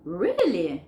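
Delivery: drawn-out, surprised "really". Shown as a demonstration. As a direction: stretch "really" and send the pitch up. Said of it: "la entonación para arriba".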